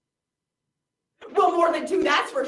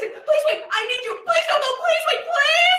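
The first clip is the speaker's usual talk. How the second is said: frantic plea as the audience leaves; desperate pleading; 10 semitones higher than usual. Acted. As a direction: plead with them not to walk out. Begging audience not to leave.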